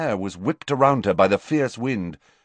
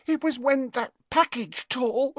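sobbing. Acted. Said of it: "sobbed Leanne".

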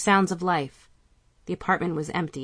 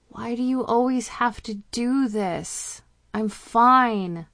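put-on higher, exasperated teen girl; annoyed teen; 6 semitones higher, 4 dB louder, slower than usual. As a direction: whine it like a cranky teenager. Annoyed daughter protests.

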